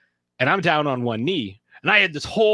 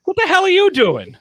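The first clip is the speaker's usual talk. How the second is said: much higher and louder, startled demand; shocked outburst; 7 dB louder than usual. Retold or imitated. Girlfriend seeing him kneel, shocked outburst. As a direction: caught off guard, half yelling. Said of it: "she goes".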